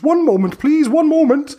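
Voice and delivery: put-on high squeaky old hag calling down from the trees; high-pitched busy hag. Hag drinks the potion.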